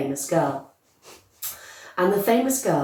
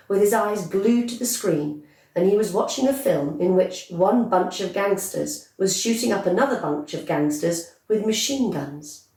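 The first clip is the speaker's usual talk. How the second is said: plain reading voice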